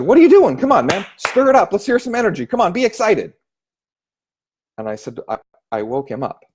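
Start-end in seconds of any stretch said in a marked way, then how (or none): 0.0-3.3 much higher and louder urging; pumped-up broker. Retold or imitated. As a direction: fire him up like a coach. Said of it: "my broker at the time came over".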